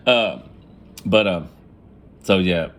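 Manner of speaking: normal talking voice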